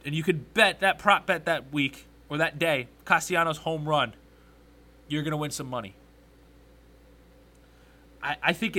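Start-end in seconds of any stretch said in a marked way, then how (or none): none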